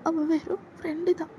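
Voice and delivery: put-on timid young woman begging him to stop; scared junior girl. Junior girl begging her senior to back off, high and timid.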